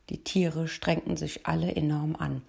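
ordinary delivery, nothing marked

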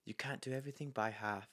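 breathy hushed mock-posh read; pretentious whisper. Mocking a movie line in a pretentious whisper. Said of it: "That sounds like a really pretentious".

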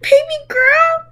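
high, slow, exaggerated accent; mock accent. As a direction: stretch out baby girl mockingly. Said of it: "with his accent".

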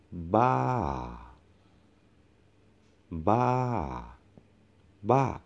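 Ba climbs high and then drops sharply; Thai falling tone. Shown as a demonstration. Teacher shows students the falling tone, peaked Ba. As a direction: arch Ba up like a mountain and let it fall. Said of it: "the mountain or falling tone".

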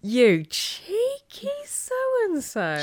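high, drawn-out teasing indignation; mock outrage. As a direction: stretch it out in playful shock. Playful outrage at partner's admission.

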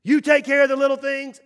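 ringing loud preaching; loud proclamation. Shown as a demonstration. Pastor proclaiming the motto to the church, loud.

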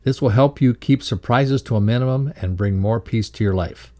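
ordinary talk, nothing marked

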